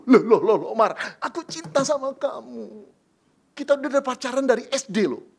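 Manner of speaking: loud laughing, brushing it off; laughing reassurance. Acted. Joseph laughingly reassures Mary of his love. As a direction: laugh it away to comfort her.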